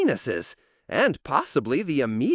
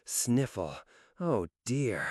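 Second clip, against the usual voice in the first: soft worried sniffle; 6 semitones lower, 8 dB quieter than usual. Acted. Another sneeze threatens.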